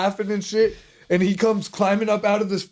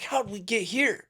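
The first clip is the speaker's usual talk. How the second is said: higher, softer, drawn-out puzzled quote; retold bewilderment; 4 semitones higher, 5 dB quieter than usual. Friend recalls suddenly standing by the pirate ship, bewildered. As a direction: ask it slowly in mock confusion. Retelling his own bewilderment.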